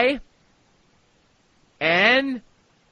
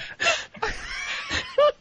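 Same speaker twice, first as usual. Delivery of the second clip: burst of laughter; 4 dB quieter than usual. Real, not acted. Prank caller breaks up laughing.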